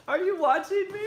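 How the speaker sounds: high, giggly question; laughing. Real, not acted. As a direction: laugh through the words. Laughing at seeing partner.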